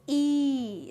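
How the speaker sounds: E held long as "eee"; drawn-out phonics E. Shown as a demonstration. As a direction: stretch the E out for the class. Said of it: "when there is a long E".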